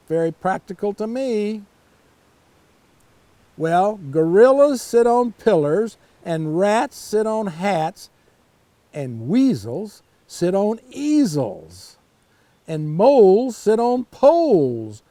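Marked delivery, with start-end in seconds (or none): 3.6-15.0 slow, sing-song rhyming list, a bit louder; reading to children. A narrator's reading. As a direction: read each rhyme out to little kids, savouring it. Reads the cat's rhyming list.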